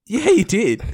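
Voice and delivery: high-pitched mock insistence; retold protest; insistent retort. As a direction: contradict him, pitched well up. Retold drunken dispute over drink.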